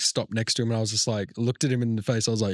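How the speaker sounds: ordinary delivery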